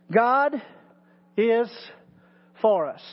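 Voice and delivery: God, is, for us spaced out with pauses; preacher lets each word of "God is for us" land slowly. Shown as a demonstration. Preacher drilling his congregation, slow and deliberate. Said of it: "take one word and emphasize".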